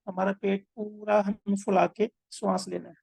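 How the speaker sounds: measured meditation guide with long pauses; unhurried breathing-exercise instruction; guided meditation. Acted. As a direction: guide them breath by breath, drawing out key words.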